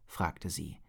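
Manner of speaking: ordinary delivery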